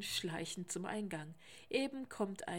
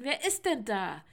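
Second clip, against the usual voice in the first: louder calling question; 3 semitones higher, 10 dB louder than usual. A narrator's reading. Sebastian shouting at the silent caller, loud call. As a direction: call out, demanding an answer. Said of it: "ruft Sebastian".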